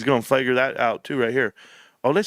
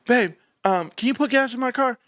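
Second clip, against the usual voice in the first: higher, sweet-asking imitation of the wife; husband imitates wife asking for gas, higher; mock wife voice; 9 semitones higher than usual. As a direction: play the wife coaxing her husband, pitched up. Voicing wife asking a favour.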